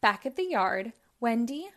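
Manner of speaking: ordinary delivery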